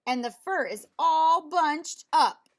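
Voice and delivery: Mama Bear complains about her boots, huffing; huffy Mama Bear. A narrator's reading. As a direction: huff at it like an annoyed mother bear. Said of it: "she huffed".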